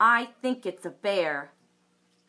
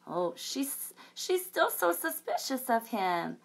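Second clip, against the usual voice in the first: plain reading voice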